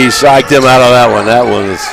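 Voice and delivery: normal talking voice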